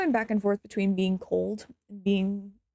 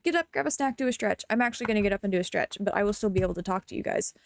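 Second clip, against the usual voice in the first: ordinary talk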